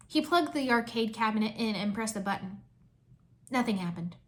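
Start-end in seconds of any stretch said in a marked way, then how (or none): none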